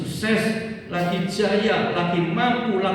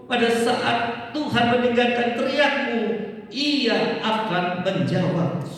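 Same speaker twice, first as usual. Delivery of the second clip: raised, ringing Bible reading; scripture reading; 5 semitones higher than usual. Acted. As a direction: proclaim the verse to the congregation. Preacher reads scripture aloud. (membaca ayat dengan suara lantang)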